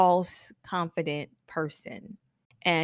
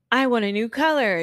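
higher, louder mock demand; assertive regulator; 5 semitones higher, 5 dB louder than usual. Regulator client telling the stylist, assertive. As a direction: declare it boldly as the client. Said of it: "mirror their personality".

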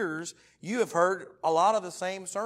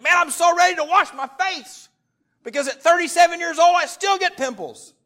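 much higher, shouted, hammed-up eagerness; mock excitement; 15 dB louder than usual. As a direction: oversell how thrilled you are to wash your face. Mock eager morning face-wash.

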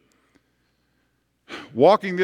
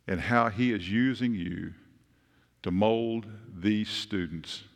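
soft, slow, sweet Southern lady, much softer and lower; Nancy impersonation; 5 semitones lower, 6 dB quieter, slower than usual. Retold or imitated. Kind church lady Nancy telling the preacher she loves him, soft and sweet. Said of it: "I'll do my best Nancy impersonation".

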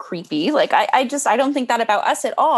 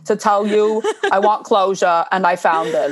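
mock Bernie meme voice; 3 semitones lower than usual. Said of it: "It's like".

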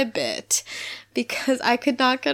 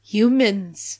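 louder, grave one-word reveal; dramatic reveal; 4 dB louder than usual. Retold or imitated. Fan quoting Zach's line as a gif moment, with a grave, dramatic voice. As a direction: deliver it like a movie trailer line. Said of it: "Just him saying".

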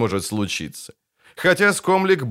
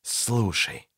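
hissing; 7 dB quieter than usual. A narrator's reading. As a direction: hiss the word quietly. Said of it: "прошипел Кайлей".

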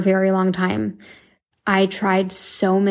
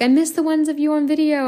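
higher, imitating a fan's plea; mock-pleading fan voice, pitched up; whiny fan; 7 semitones higher than usual. Fans telling the host they miss the videos, mock plea. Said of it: "when they're like".

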